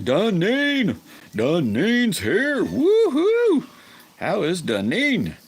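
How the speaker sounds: high, loud whooping welcome; excited shout. Real, not acted. Streamer cheering as viewer Dunedin joins, whooping shout.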